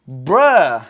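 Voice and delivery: ordinary delivery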